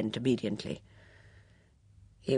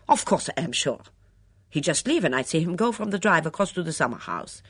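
put-on a touch of defiance in Mitzi's foreign accent, louder; defiant; 4 semitones higher, 6 dB louder than usual. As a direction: insist stubbornly in the foreign accent. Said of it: "said Mitzi defiantly".

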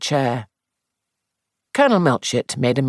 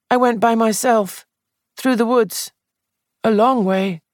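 higher, anxious, upset voice; fretful, guilty young man, voiced high; distressed young man; 10 semitones higher than usual. Sandford denies, defensive.

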